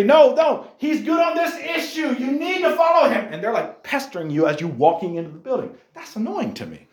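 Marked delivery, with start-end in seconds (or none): 0.0-3.3 high, half-laughing shout of a canvasser; pushy campaigner. Retold or imitated. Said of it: "And they say".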